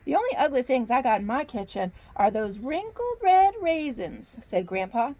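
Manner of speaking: lightly voiced Southern grandpa bear; Southern accent. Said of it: "I get a Southern accent".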